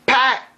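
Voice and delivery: sharp high loud "Pat!" like a hammer snap; gunshot click. Retold or imitated. Retelling the misfire, loud mock gun noise.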